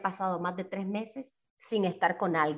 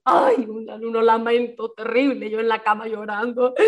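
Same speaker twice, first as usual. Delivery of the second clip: mock lament. Recalling crying in bed.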